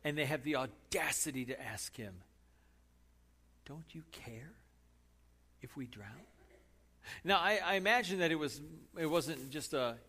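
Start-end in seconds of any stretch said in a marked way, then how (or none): 3.6-6.3 hushed plea. Acted. Preacher softly repeating the disciples' question. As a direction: murmur it, almost to yourself.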